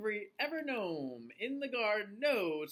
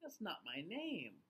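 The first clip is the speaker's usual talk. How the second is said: quiet and sulky; 10 dB quieter than usual. Acted. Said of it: "Ginger frowns".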